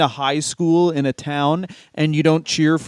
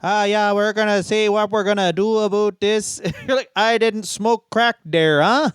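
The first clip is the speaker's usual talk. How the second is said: higher Canadian hoser accent, played for laughs; 5 semitones higher than usual. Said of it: "a hoser accent".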